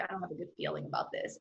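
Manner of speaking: quick, hushed worry to herself; worried inner mutter. Retold or imitated. Waiting at immigration, she mutters her doubt quickly and quietly. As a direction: mutter the doubt under your breath.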